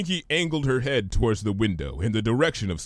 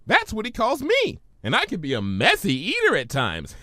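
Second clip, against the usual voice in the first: much higher, bubbly pony; Pinky's voice; 10 semitones higher than usual. Acted. Pinky puzzled by Twilight's big word, high voice.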